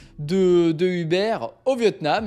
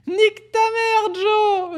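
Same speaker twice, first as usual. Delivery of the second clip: high, slow put-on voice, laughing through it; mocking high-pitched character voice; imitating a viral video; 12 semitones higher, 3 dB louder, much slower than usual. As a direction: replay the lockdown video gag in a squeaky voice. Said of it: "ça me rappelle pendant le confinement".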